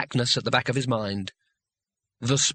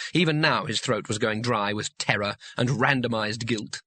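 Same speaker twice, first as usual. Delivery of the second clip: usual speaking voice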